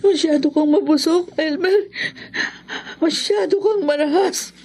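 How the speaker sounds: tearful reproach; weeping, distressed protest; crying. Acted. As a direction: accuse him through sobs. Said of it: "Ano yung iyak mo dyan".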